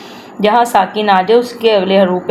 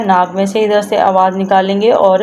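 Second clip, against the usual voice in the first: ordinary talk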